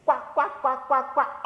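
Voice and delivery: soft, slightly high quacks; duck quacking. Shown as a demonstration. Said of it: "And duck says".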